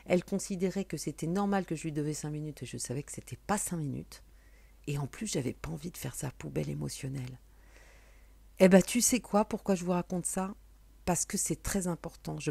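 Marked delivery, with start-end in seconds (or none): none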